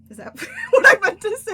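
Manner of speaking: high, loud question through laughter; laughing disbelief. Real, not acted. Speaker catching their own wrong word, laughing loudly in disbelief. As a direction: squeal it, cracking up.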